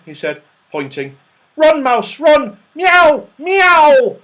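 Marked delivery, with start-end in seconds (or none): 1.5-2.6 high, loud teasing shout; high, loud shout at a fleeing toy mouse; gleeful chasing. A narrator's reading. 2.7-4.2 high, shouted meowing; loud, high mock cat yowls. A narrator's reading. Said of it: "heard Saucepan meowing".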